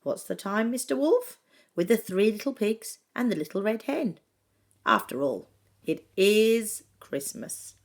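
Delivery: ordinary delivery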